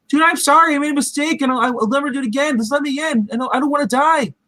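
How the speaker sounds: high, desperate plea; frantic begging. Retold or imitated. Trapped kid begging the others at the door, high and frantic. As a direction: beg for your life, voice way up.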